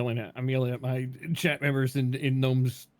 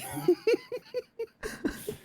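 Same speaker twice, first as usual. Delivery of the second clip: squealing high laugh; high giggle; 5 dB quieter than usual. Real, not acted. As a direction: burst into a high-pitched giggle. Laughing at gang invite.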